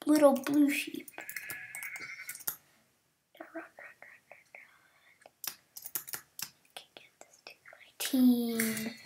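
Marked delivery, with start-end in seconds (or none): 3.4-9.0 breathy urgent whisper. Real, not acted. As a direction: whisper urging yourself on. Whispering while running.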